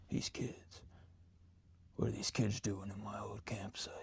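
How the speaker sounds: eerie unvoiced whisper from outside; creepy whisper. Counselor pranking campers outside the tent, creepy whisper. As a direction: breathe it like a lurking stranger.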